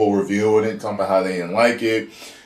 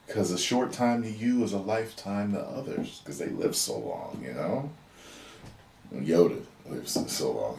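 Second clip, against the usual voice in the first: a softly voiced Luke, much softer; Luke speaking gently; 8 dB quieter than usual. Luke offering Grogu the choice, softly voiced. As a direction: hush it like a Jedi master offering a choice. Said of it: "He said".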